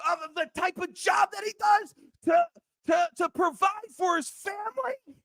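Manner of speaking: real outrage, voice cracking high; heated rant. Real, not acted. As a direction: yell it like the guy's listening. Ranting at the player on air.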